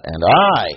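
"I" stretched with a rise and fall; stress on I. Shown as a demonstration. Teacher showing how he read it, emphatic I. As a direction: punch "I" after a light "and". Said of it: "the way I read that".

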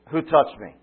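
calls out loudly over a crowd. Acted. Jesus turns, calls to crowd.

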